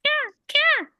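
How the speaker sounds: put-on a tiny baby raven's light caw. Baby raven calling, high and light. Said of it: "Baby raven says, softer sound".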